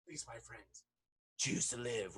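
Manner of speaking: hushed, low plea in a mock standoff; understated threat. Swordsman with blade at a neck, quietly warning. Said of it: "and then says the same line".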